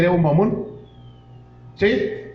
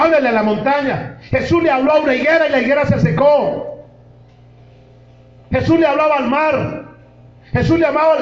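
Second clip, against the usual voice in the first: high, loud pulpit preaching; fiery sermon voice, pitched high; full-force preaching; 10 semitones higher, 4 dB louder than usual. Real, not acted. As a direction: preach it out to the congregation.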